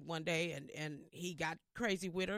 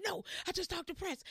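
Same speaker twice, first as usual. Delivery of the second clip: high, emphatic no; excited insistence; 11 semitones higher than usual. Retold or imitated. Mother doesn't believe her, high excited insisting. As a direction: insist it's true, thrilled.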